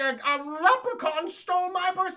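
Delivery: high, loud, hammed-up complaint; mock frantic victim. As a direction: play a distraught woman reporting a theft. Retold woman reporting theft.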